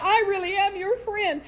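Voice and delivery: very high, put-on sugary pleading; mock sympathy. Coworker reaching for her shoulder, mock sympathetic. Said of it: "in a condoling, sympathetic way".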